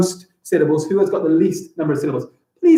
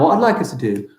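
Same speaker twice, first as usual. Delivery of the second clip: usual speaking voice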